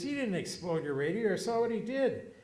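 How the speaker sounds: mock-angry Johnny; hot-tempered mechanic. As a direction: snap back at the customer. Johnny defends the kid.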